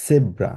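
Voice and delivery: জেব্রা with an initial s sound, as in German; German pronunciation. Shown as a demonstration. Teacher giving learners a German example, জেব্রা said "sebra". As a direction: begin জেব্রা with a hissless German-style "s" for z, "sebra". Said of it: "জার্মানে এটাকে বলা হচ্ছে জেব্রা".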